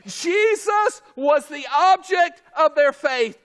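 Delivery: insistent preacher cadence, starting at a shout; full-force preaching. Acted. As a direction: hammer each point home to the congregation.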